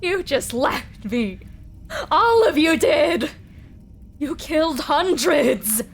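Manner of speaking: a wounded monster lashing out at those who left him; bitter monster. Acted. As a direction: monster accusing the captives, voice nearly breaking. Monster berating his captives.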